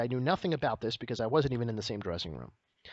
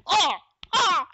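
very high, loud cries of pain; pained yelp; 8 dB louder than usual. Retold or imitated. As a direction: yelp like a man punched in the face. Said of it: "the noise he makes when he gets hit".

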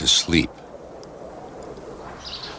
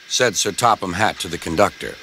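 usual speaking voice

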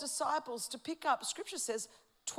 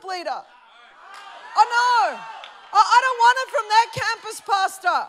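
higher, louder, whiny mock congregant; self-important complainer; 8 semitones higher, 14 dB louder than usual. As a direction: whine like a proud member who won't be served. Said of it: "you've got the people going".

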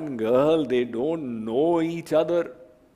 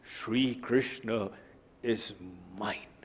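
slow, much softer and lower; hushed devotion; 5 semitones lower, 9 dB quieter, slower than usual. Shown as a demonstration. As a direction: whisper it like a vow.

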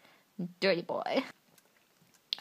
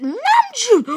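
high, loud squeal of the name; 9 semitones higher, 6 dB louder than usual. Real, not acted. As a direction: shriek his name in delight. Fangirl screaming a name.

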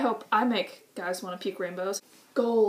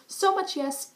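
excited; 9 semitones higher than usual. Real, not acted. Gushing over nerdy guys.

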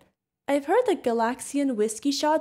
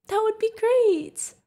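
delighted; 11 semitones higher, 4 dB louder, slower than usual. Real, not acted. Customer might visit next year.

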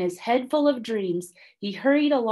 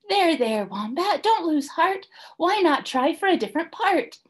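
high, soothing consoler; kind Numbat; 10 semitones higher, 3 dB louder than usual. A narrator's reading. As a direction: pat him with your voice. Numbat comforts Wombat.

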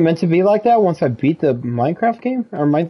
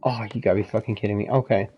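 defeated mutter; 8 semitones lower, 7 dB quieter than usual. Real, not acted. Door still locked.

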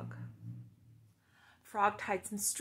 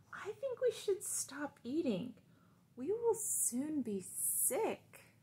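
higher, slow Frog voice; 7 semitones higher, slower than usual. Acted. Frog tells Toad they should stop eating, higher voice. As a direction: speak as Frog, gently warning. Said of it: "said Frog with his mouth full".

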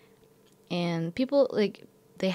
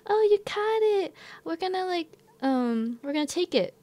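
much higher, chirpy and pleased; high bright neighbours; 11 semitones higher than usual. Retold or imitated. Neighbours arriving to claim the cat.

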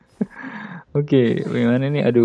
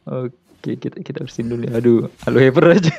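ordinary talk

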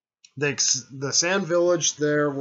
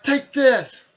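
much higher and louder attack cry; battle shout; 13 semitones higher, 10 dB louder than usual. Retold or imitated. The team strikes at the puppets, yelling. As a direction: yell it as you strike.

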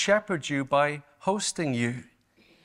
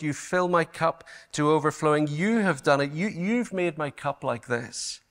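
ordinary talk

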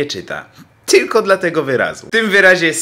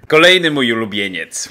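normal talking voice

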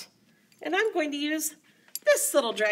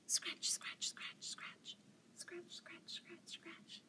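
hushed whispered chant; whispering; 7 semitones higher, 20 dB quieter, slower than usual. Shown as a demonstration. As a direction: whisper the scratch scratch.

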